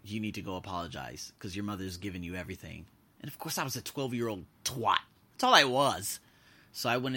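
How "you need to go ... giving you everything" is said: put-on stern boyfriend. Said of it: "He's like".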